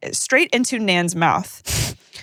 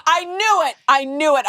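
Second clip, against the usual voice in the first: high, excited "I knew it"; gleeful; 8 semitones higher than usual. Real, not acted. Hearing the gossip confirmed, gleeful. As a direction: crow like you called it. Triumphant reaction to news.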